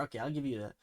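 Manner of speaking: ordinary delivery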